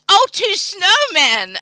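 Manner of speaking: high, loud burst of getting it; sudden realisation. Real, not acted. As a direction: exclaim as the joke finally lands. Suddenly gets the joke.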